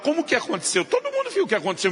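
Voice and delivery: high, fast, exasperated disbelief; father baffled by the obvious question, high and exasperated. Real, not acted. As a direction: everyone saw it, so say it incredulous. Exasperated aside at mother's question.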